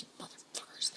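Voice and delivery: whispered cursing under the breath; muttered curse. Retold or imitated. Driver turned back by the military, whispered curse. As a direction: barely voice it, a private swear.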